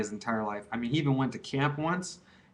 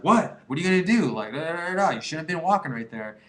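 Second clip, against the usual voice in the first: cocky challenge; 4 semitones higher, 3 dB louder than usual. Retold or imitated. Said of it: "He was just like".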